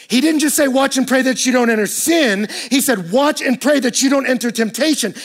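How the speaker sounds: loud, high, driving sermon delivery; preaching at full force. Acted. Pastor pressing the congregation about loneliness, at full force. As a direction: preach it out, building.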